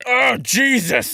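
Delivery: high, drawn-out recoil; high-pitched stretched outburst; startled exclamation. Real, not acted. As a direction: cry out in shock, stretching it. Recoiling from a burp.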